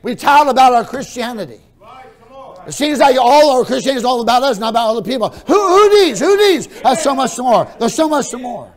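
high, very loud preacher's shout; impassioned, high-pitched pulpit roar; full-force preaching. Real, not acted. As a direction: thunder it at the congregation.

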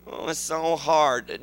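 slow, soft mock whine. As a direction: drag it out like a lazy complainer. Mocking self-pity.

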